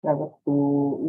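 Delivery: normal talking voice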